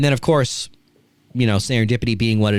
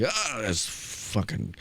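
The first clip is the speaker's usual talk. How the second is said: mock mutter, much softer and slow; muttering to himself; 11 dB quieter, much slower than usual. Host imitating Vince cursing at himself after the crash. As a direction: grumble under the breath like a dazed old man. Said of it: "And he's like".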